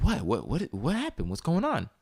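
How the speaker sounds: high, alarmed repeated what; jumping up in alarm at her sudden freeze; startled questions. Retold or imitated. Girlfriend startled at dinner, high alarmed questions.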